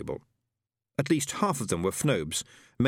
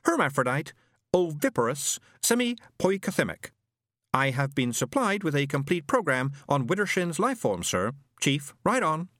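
higher, precise machine voice; pompous robot; 6 semitones higher than usual. A narrator's reading. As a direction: list it like a know-it-all robot. Robot Isaac speaks.

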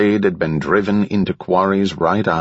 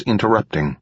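plain reading voice

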